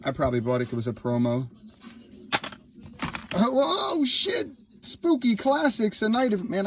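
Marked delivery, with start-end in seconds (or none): 3.3-6.7 high-pitched amazed whoa, easing downward; excited surprise. Real, not acted. Vlogger finding Spooky Classics in his old box, excited surprise. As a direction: react with delighted surprise, voice shooting way up.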